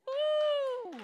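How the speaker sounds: high, loud ecstatic "uh" shout; sudden high whoop of worship. Real, not acted. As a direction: let a burst of praise rip out. Preacher erupting mid-sermon.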